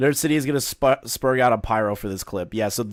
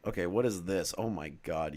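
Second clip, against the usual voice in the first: usual speaking voice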